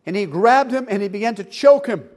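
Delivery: usual speaking voice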